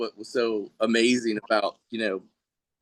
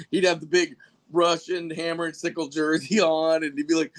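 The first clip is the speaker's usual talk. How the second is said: usual speaking voice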